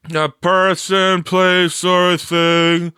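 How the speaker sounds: high, loud, slow sing-song chorus; class in unison. A narrator's reading. Students answering the teacher together, loud sing-song. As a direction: chant it like a class reciting. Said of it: "said the class in unison".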